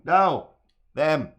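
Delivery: loud high No; shouting a quick denial; sharp shout. Real, not acted. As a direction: bark out a loud sudden no. Sudden shout at chat.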